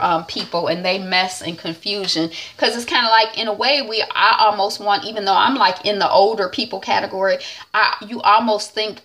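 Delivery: ordinary talk, nothing marked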